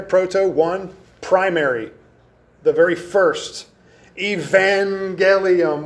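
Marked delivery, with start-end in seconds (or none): 4.2-5.8 "Evangelium" stretched out to half speed; sounding out slowly. Shown as a demonstration. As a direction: draw out "Evangelium" so listeners hear its root. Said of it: "what does that sound like?".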